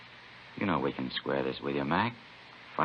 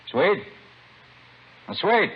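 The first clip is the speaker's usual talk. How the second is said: shouted call, much higher; 13 semitones higher, 6 dB louder, slower than usual. Acted. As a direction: yell across the dark hillside to the lookout. Calling lookout across the hill.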